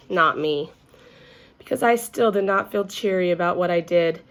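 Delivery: usual speaking voice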